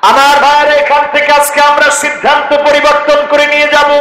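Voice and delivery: high monotone sermon chant; full-force preaching. Real, not acted. Preacher urging brothers to make a resolution, full-force chanted preaching. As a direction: exhort the brothers on one sustained note.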